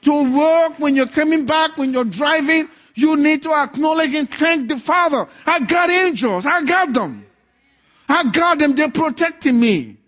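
loud, high preaching voice, building; fiery preaching. Acted. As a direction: preach it to the congregation. Preacher at full force.

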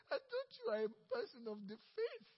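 high, whiny jab at the believer; teasing a fellow worker in a high whine; mock reproach. Coworker's disappointed reproach.

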